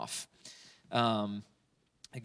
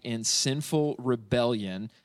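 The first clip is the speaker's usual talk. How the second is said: in sinful rebellion said slowly and deliberately; slow down and weigh each word of sinful rebellion; slow and weighty; 4 dB louder, slower than usual. Shown as a demonstration. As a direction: drive home sinful rebellion. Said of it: "understanding that I".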